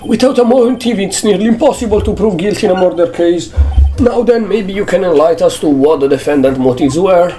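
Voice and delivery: high, smug prosecutor voice; squeaky courtroom rival. Acted. Voicing the prosecutor.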